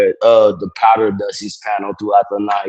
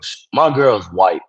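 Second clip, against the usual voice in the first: ordinary talk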